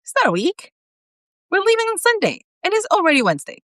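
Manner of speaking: high cheery reassurance; cheerful Bob. A narrator's reading. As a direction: cheer her up, pitched high.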